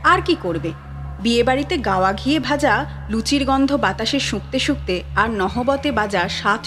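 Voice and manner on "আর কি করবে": ordinary delivery, nothing marked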